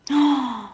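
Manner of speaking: sharp audible gasp; shocked gasp. Real, not acted. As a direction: draw in a startled breath. Reacting to record heat in city.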